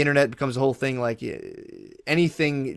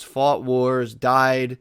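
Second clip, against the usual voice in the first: normal talking voice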